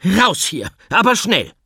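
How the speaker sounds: high, loud shooing command; shouting them out. Acted. Teacher shooing the animals outside, loud command. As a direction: chase them out the door.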